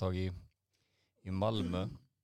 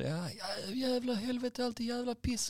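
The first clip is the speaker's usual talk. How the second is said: high-pitched screaming caller, cursing; mock-furious high yelling; screamed rant; slower than usual. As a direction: imitate an enraged customer shrieking down the phone. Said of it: "han bara skrek".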